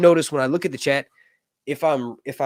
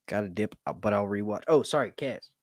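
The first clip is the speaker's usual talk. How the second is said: usual speaking voice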